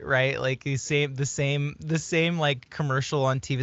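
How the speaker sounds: ordinary delivery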